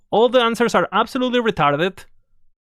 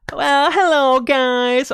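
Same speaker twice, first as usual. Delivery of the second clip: higher, louder put-on nasal-free squeaky teacher voice; mock the host with a grating, higher voice; annoying voice; 6 semitones higher, 3 dB louder, much slower than usual. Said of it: "a fucking annoying as shit voice".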